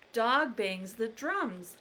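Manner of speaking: ordinary delivery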